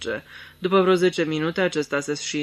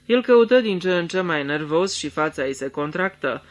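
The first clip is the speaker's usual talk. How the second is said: ordinary delivery, nothing marked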